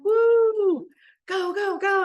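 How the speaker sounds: very high, loud whooping cheer; cheering send-off. Pretend. Family waving the soul off to Earth, whooping very high and loud. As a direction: whoop them out the door like proud parents.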